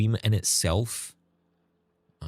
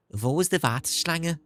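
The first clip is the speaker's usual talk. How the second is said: put-on German line in a Scouse accent, higher; 8 semitones higher than usual. Streamer shows a mate's Scouse German, higher Scouse accent. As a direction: Liverpool vowels on Wo ist die Warteschlange. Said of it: "a Scouse accent sound like when speaking German".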